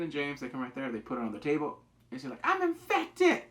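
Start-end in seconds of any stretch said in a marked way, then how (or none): 2.4-3.4 much higher, louder declaration; a girl bluffing she is infected; dramatic reveal. Retold or imitated. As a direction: announce it to scare them. Ellie bluffing captors.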